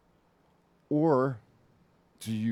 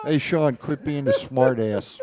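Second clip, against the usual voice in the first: loud, raised-pitch telling-off; mock scolding shout; 4 semitones higher, 8 dB louder than usual. Real, not acted. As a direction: shout down the smart aleck.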